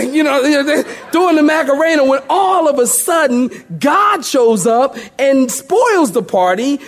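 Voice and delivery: high, loud, animated preaching; preaching at full force. Acted. Preacher acts out Belshazzar's fright for the church, high and fired up. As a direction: preach it with rising fire and humor. Preacher builds the story excitedly.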